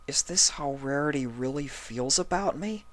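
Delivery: hurt thought. A narrator's reading. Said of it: "he thought to himself".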